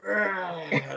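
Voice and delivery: a short, loud, threatening grunt; menacing grunt. Retold or imitated. Animal glaring across the ring, loud menacing grunt. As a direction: grunt it out like a big wrestler sizing you up. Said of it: "looked across the ring at me and went".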